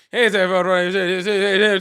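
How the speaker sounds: rapid-fire imitation auction patter; mock auctioneer. Host mocking a fast-talking caller, auctioneer gabble. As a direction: gabble it like an auctioneer, words blurring together. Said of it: "I can't talk that damn fast".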